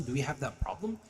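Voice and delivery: normal talking voice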